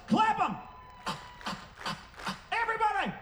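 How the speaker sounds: high-pitched call to the crowd; crowd command. Real, not acted. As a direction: holler it out to get everyone clapping. Calling crowd to clap along.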